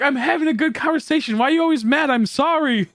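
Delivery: high, imitated hurt friend; mock-hurt friend saying sorry, pitched high; hurt friend apologizing. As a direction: play the wounded friend, voice up high.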